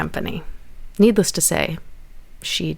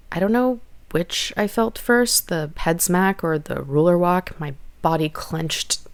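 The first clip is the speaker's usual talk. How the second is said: ordinary delivery, nothing marked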